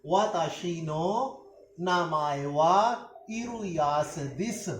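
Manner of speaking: syllable by syllable, very slow; slow Japanese demo. Shown as a demonstration. Teacher to students, the Japanese sentence very slowly. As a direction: lay out each Japanese word slowly. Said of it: "अब हम इसको Japanese में करते हैं".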